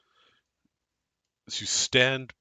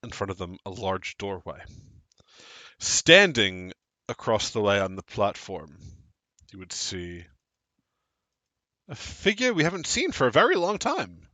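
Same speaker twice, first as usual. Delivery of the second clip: scene narration; 3 semitones lower than usual. Acted. Game master narrates the scene.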